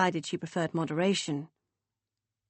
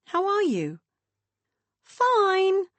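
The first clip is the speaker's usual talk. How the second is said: high, louder sing-song sister voice; 12 semitones higher, 6 dB louder, much slower than usual. A narrator's reading. Beth chirping down the phone in a high sing-song. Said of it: "said Beth in her sing-song voice".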